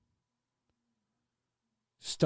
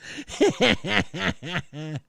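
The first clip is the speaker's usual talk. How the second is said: laugh; 4 dB quieter than usual. Real, not acted. Laughs after reading.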